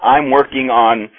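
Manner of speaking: ordinary talk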